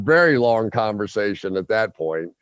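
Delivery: usual speaking voice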